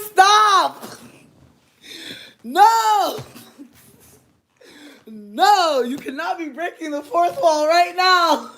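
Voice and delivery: loud, high shrieks of protest; laughing outcry. Real, not acted. Telling Andrew to stop breaking the fourth wall, loud laughing protest. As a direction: yell stop through laughter.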